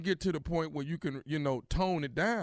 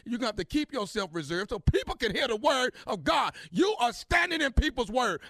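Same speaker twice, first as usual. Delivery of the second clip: scolding shout; 10 semitones higher than usual. Acted. Caller scolds the churchgoer.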